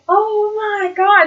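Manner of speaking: high, slow awed oh my god; the crowd's stunned gasp of awe; drawn-out high crowd awe. Retold or imitated. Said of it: "everyone's like".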